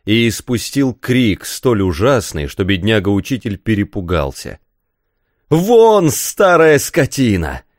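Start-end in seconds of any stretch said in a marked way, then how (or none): 5.5-7.6 loud, high-pitched angry cry; furious shout. A narrator's reading. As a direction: shout it out to drive the old man away. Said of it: "крикнул Рафаэль". (яростный крик)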